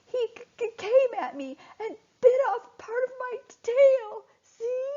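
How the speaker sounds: high, sobbing stammer; tearful stammer showing his bitten tail; stuttering crying. Acted. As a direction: break up the words as you cry. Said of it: "stuttered Finlock".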